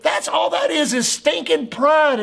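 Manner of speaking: sharp high call; preacher's outburst. Real, not acted. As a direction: cry it out from the pulpit.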